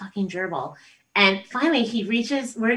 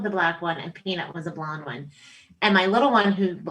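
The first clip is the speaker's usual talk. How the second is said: usual speaking voice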